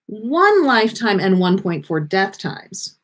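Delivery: ordinary talk, nothing marked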